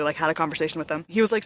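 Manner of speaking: ordinary talk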